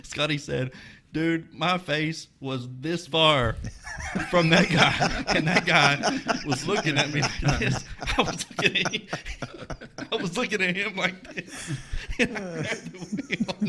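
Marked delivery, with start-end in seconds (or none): 1.1-7.8 laughing through the retold words; laughing quote. Friend retelling it while laughing. 8.2-13.6 words squeezed out through laughter; laughing. Real, not acted. Friend laughing through his own story.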